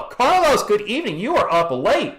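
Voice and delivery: high, bright shoutout; cheerful greeting. Real, not acted. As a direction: greet a viewer warmly.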